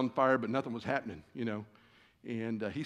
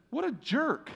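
high, playful indignation; mock outrage; 12 semitones higher, slower than usual. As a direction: fake offended friend. Mock outrage at friend's note.

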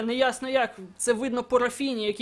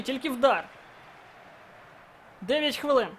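normal talking voice

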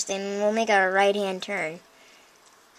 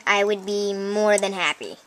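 ordinary talk

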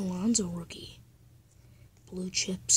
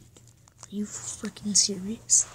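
ordinary delivery

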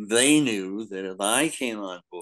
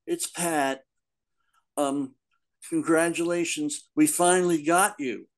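higher-pitched cheerful phone-message voice; Pat Bastian; 7 semitones higher than usual. Retold or imitated. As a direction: imitate an assistant leaving congratulations, pitched up. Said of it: "it was Pat saying".

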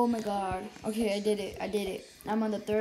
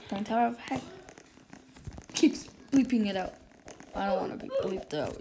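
ordinary talk

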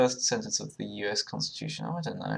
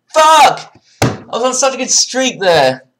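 screaming much higher and louder in rage; furious shout; 19 dB louder, slower than usual. Real, not acted. Gamer losing his answer streak, furious scream.

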